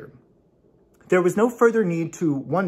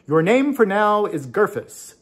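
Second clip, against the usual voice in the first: higher, louder mysterious pronouncement; 7 semitones higher, 5 dB louder than usual. Acted. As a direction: announce it like an unseen oracle. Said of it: "said a mysterious voice".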